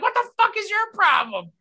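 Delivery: high mock shriek of a fed-up wife; mock exasperated wife. The wife snapping at Tony over turkey, high mock exasperation. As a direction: throw it back at him, exasperated.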